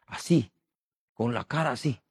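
ordinary delivery

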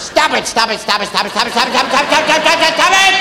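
rattled stop it. Retold or imitated. Parent rattling orders.

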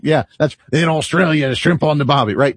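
hammed-up Australian accent, jokey; a terrible mock Australian impression; bad Australian accent. Host mocking Australia for laughs, bad Australian accent. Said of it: "okay, it's terrible".

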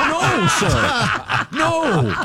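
high, loud laughing no sir; laughing protest. Real, not acted. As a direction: laugh out the refusal. Laughing at the gun punchline.

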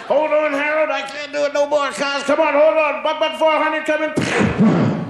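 begging a friend to hold on; straining kid pleading. Retold or imitated. Kid under the pile pleading.

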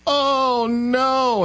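very high wailing oh no; a mock anguished old aunt cry; aunt dismayed. Aunt hearing he's Republican, very high mock wail. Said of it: "And she started crying".

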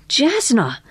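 high-pitched exclamation of recognition; exclaiming. A narrator's reading. Friend recognizing a sketched face, exclaiming. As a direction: exclaim the name on a high note. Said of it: "exclaimed Missus Polyfax".

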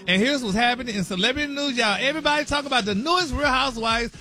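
high, loud show-opening greeting; radio show greeting. Acted. Gossip reporter greets host and America, big announcer greeting. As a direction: belt out a big morning hello to the listeners. Opens a celebrity news segment.

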